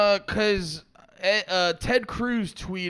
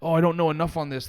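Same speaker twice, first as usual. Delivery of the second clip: quick self-talk; faster than usual. Retold or imitated. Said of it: "I constantly will be like".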